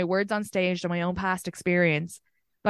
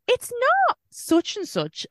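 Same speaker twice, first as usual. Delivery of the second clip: slow, much higher disbelief; retold incredulous guess; slower than usual. Woman guessing who the strangers mean, retold incredulity. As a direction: draw it out high, acting out dawning recognition. Retelling her guess at the man's identity.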